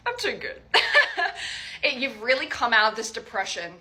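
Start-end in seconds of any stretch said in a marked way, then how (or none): 0.1-0.6 playful self-praise pitched way up; mock smug brag. Real, not acted. Smug joke about own reading. 0.7-1.8 airy giggle pitched way up; high breathy laugh. Real, not acted. Laughs at own brag.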